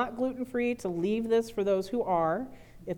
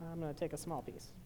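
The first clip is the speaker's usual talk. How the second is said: normal talking voice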